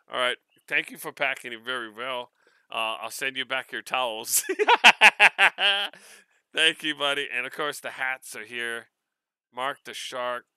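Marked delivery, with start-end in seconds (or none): 4.5-6.1 loud rising burst of laughter. Real, not acted. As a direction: let a big laugh out. Laughing at the towels.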